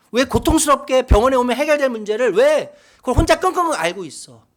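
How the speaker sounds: loud, high-pitched fatherly reproach, building in force; upset father scolding. Retold or imitated. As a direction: scold your child with anguished urgency. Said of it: "입양한 아들에게 이야기하는 거예요".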